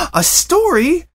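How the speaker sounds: high, bouncy chatterbox pony voice; breathless cheerful pony chatter; bubbly Pinkie Pie. A narrator's reading. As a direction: gush it out like an over-eager party pony.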